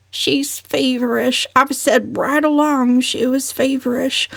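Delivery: slow, high moan; moaning worry. A narrator's reading. Cousin Stickles fretting over Valancy, mild moan. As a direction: fret it out mournfully. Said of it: "moaned Cousin Stickles".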